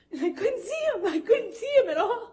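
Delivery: high, choked laugh-cry; laughing it off through tears. Real, not acted. Woman telling her boyfriend's rage, laughing through tears. Tearful laughter recounting fear.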